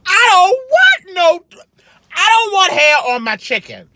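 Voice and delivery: high-pitched, emphatic complaint; exasperated rant. Real, not acted. Vlogger fed up with hair on chicken, exasperated rant.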